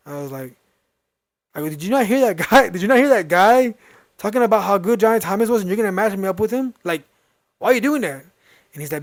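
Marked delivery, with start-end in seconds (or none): 1.7-8.2 higher-pitched, disbelieving retelling; incredulous protest. Retold or imitated. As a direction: challenge him in mock disbelief. Protesting dad's choice of opponent.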